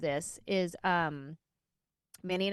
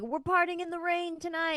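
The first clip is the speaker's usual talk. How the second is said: high-pitched imitation of a stage shout; mock performer's shout; 9 semitones higher than usual. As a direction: imitate a singer hyping the crowd, pitched high. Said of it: "he would shout".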